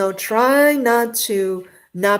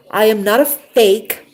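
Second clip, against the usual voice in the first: emphatic; 3 semitones higher, 8 dB louder than usual. Real, not acted. Insisting she is genuine.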